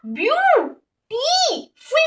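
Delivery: "Beautiful" in three drawn-out, separated syllables. Shown as a demonstration. Teacher counting syllables for students, word broken into slow beats. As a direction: split "beautiful" slowly into view, टी, फुल. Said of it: "कितने syllable".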